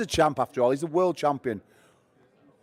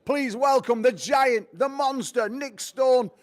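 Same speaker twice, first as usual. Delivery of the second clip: big, loud, drawn-out fight-night introduction; ring announcer intro; 6 semitones higher, 4 dB louder, slower than usual. Acted. Announcer presents Nick Stone to the crowd, loud and showy. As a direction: hype the crowd for the final match.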